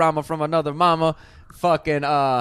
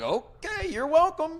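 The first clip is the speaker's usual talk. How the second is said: high mock politeness; 13 semitones higher, slower than usual. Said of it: "I was like".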